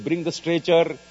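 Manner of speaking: mildly urgent retold yell; retold shout. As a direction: re-enact calling out an urgent order. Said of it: "I shouted".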